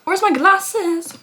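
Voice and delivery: too much caffeine. Real, not acted. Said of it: "I've had, like, three cups of coffee today".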